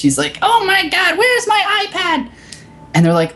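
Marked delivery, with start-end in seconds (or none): 0.4-2.3 very high, quavering mock old lady; furious old lady. Retelling the Apple store story, a very high mock furious old lady. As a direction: squawk like an outraged old customer. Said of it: "she is just mad, furious".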